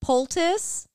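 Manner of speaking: poultice said hesitantly as POHL-tus with a rise; tentative POHL-tus, rising like a question; unsure pronunciation. Real, not acted. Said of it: "I should have looked up how to pronounce it".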